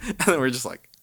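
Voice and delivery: usual speaking voice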